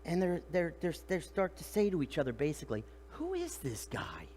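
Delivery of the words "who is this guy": slow, soft, low-pitched wonder; acted hushed amazement; awed disbelief. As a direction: ask it as if dumbfounded. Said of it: "fear is like awe and disbelief".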